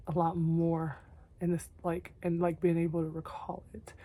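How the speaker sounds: ordinary delivery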